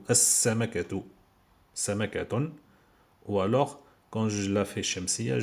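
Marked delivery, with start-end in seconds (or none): 0.1-1.0 "As-Samakatu" with a long doubled s and no L; as-samakatu, hissing s held; L assimilated. Shown as a demonstration. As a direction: go straight into the s of "As-Samakatu". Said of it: "je le prononce pas".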